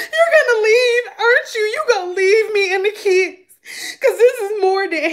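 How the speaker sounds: high mock-sobbing accusation; crying accusation. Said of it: "I started crying".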